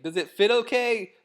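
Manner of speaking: high, loud mock call; imitating partner. Imitating partner outside the dressing room door, high loud call. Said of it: "and you're like".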